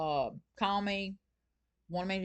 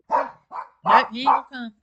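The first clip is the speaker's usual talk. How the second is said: much louder mock scold at the pet; teasing the dog; 4 semitones higher, 12 dB louder, slower than usual. Real, not acted. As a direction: call out the stubborn dog playfully. Dog refuses to come.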